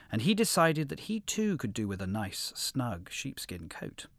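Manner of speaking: plain reading voice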